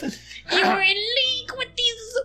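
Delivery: high, slow accusing; outraged villager. Acted. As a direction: drag it out in disgust.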